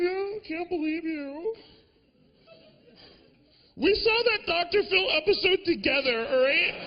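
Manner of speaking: girlfriend lightly voiced, exasperated; upset girlfriend. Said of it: "she was sad".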